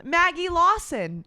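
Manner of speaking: normal talking voice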